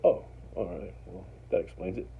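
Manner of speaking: low, soft imitation of a man backing off; calmed down. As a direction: let the anger drop into a quiet, low acceptance. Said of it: "calms down".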